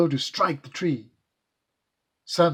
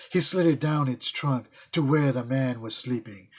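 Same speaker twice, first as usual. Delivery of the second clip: ordinary delivery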